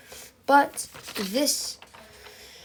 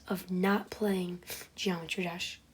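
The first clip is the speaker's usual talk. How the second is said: whispering; 3 semitones lower, 7 dB quieter than usual. Acted. Dramatic storytelling whisper.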